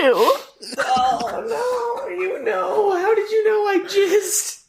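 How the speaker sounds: mock shocked high squeal. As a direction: shriek in fake shock.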